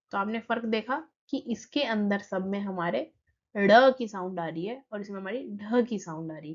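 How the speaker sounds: ordinary talk, nothing marked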